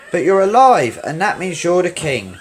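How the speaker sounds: high, loud burst of joy; exclaiming. Acted. Nala thrilled to find Simba alive, loud and high. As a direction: blurt it out, thrilled. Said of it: "exclaimed Nala".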